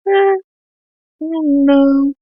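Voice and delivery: high, drawn-out noncommittal reply; hesitant. Retold or imitated. Daughter answers dad's concert invitation, hesitant.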